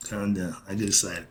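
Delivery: very soft, low self-talk; hushed, low aside after getting worked up; calming self down. Real, not acted. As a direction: talk yourself down quietly. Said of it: "I gotta calm myself down".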